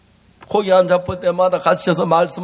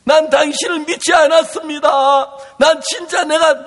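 anguished confession; 7 semitones higher than usual. Acted. Peter's anguished confession acted out.

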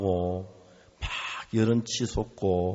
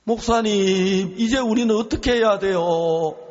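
higher, louder, pleading through tears; retold wailing plea; 7 semitones higher, 5 dB louder than usual. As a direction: cry out to the pastor in despair, voice breaking. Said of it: "갑자기 사모님 막 통곡을 하기 시작하는 거예요".

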